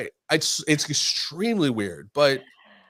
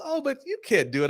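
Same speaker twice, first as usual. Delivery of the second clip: mock lady voice, pitched much higher; a hammed-up, prim stranger insisting; imitating an insistent older lady; 14 semitones higher than usual. Retelling the lady at the bar, mock high insistence. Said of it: "She goes".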